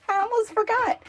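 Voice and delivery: a laugh that spills into the words, pitched high. Real, not acted. Showing viewers her van, suddenly remembering the fridge, laughing. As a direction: laugh at your own forgetfulness.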